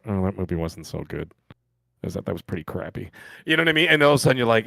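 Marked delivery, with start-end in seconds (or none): 0.1-3.1 low, soft, deflated second thoughts; mock letdown. Moviegoer reconsidering the film weeks later, acted letdown. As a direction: sink into acted disappointment, quiet and low.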